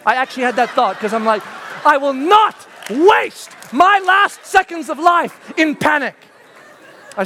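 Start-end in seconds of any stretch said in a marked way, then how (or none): 1.9-6.1 defiant resolve. Retold or imitated. Defiant resolve while jumping.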